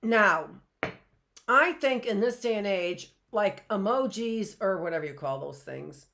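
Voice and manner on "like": usual speaking voice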